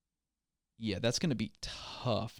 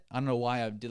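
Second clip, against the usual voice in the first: ordinary talk, nothing marked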